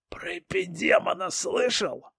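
a hint of a menacing hiss; hissed menace. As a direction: lean in and hiss the question. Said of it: "прошипел".

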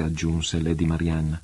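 plain reading voice

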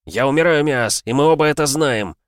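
impassioned shout; heated objection. A narrator's reading. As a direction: plead the point over the storm. Arguing with captain in the storm.

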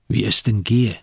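ordinary delivery

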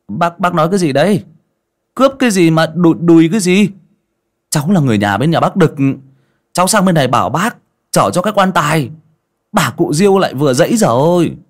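high, loud, quick indignant explaining; bewildered protest. A narrator's reading. Young customer wrongly called a robber explains his errand, bewildered and loud. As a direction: protest in baffled, rising pitch.

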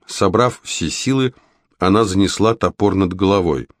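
plain reading voice

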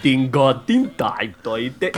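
low Star Wars gibberish dialect; mock alien language. Host mocking co-host's French, Star Wars alien dialect. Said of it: "that's all Star Wars dialects to me".

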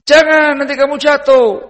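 put-on high, loud cry to stop a child; shouted warning. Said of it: "Teriak dia".

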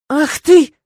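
loud, high burst of outrage; indignant outburst. A narrator's reading. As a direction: burst out, scandalized. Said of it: "возмутилась я".

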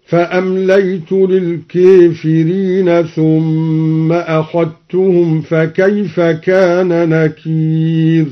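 slow chant, موسى towards "mūsē", أخذتهم as "akhattuhum"; taqlil and idgham recitation. Shown as a demonstration. Teacher demonstrating al-Duri's reading, slow chant with tilted موسى and merged أخذتهم. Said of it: "قلل الدوري موسى، وأدغم الذال في التاء".

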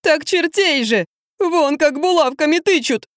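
high, slow, acted tearful whine; sobbing wail. A narrator's reading. Drunk man complains of devils poking him, sobbing. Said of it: "зарыдал Юрий".

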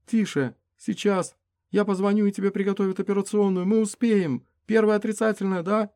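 frantic, high and loud; man down shout. Acted. Frightened woman tends wounded.

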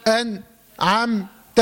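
very slow أنعمت cut into "an... am... ta"; wrongful pausing. Shown as a demonstration. Teacher shows forbidden silence, أنعمت split by pauses. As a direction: leave a silent gap after each closed syllable of أنعمت. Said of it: "ما اسكتش".

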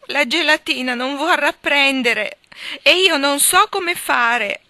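high, loud, slow crying voice; tearful wail. A narrator's reading. Young wife sobs to her husband that the jelly won't set. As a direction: wail it through tears, dragging the words.

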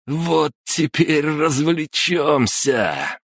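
put-on high, slow, stumbling drunk voice; drunk stammering. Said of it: "неестественно рассмеялся и, слегка запинаясь". (пьяно, запинаясь)

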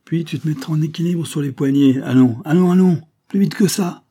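high, building to loud hustling shouts; harry the child along; impatient urging. A narrator's reading. Banquiste hurries child into handstand, impatient shouting.

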